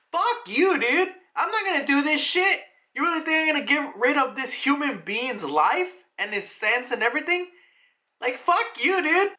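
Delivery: very high-pitched acted outrage; Vegapunk, pissed off. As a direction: refuse indignantly, voice shooting way up. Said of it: "Vegapunk gets pissed off".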